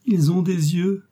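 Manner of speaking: high, slow, pained outcry; unspeakable sorrow. A narrator's reading. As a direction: lift the voice in drawn-out anguish. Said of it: "avec un accent d'indicible douleur".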